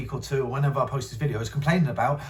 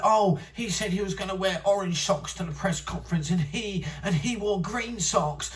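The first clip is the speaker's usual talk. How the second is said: higher-pitched sulky whining mimicry; mocking whine; 6 semitones higher, 3 dB quieter than usual. Mocking the podcaster's petty gripes in a whiny voice. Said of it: "you're like".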